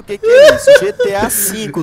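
loud, laughing explanation; laughing shout. Real, not acted. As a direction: shout it while cracking up. Explains roleplay, laughing loudly.

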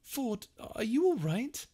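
worried Arthur. A narrator's reading. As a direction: check on a stunned friend. Arthur worried about Ford.

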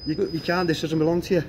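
loud, heated telling-off. Real, not acted. Guard angrily tells filmer this doesn't belong to him, loud. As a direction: shout him down over the camera.

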